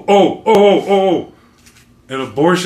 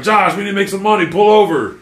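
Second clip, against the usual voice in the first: imitating client; 7 semitones higher, faster than usual. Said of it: "this motherfucker would be like".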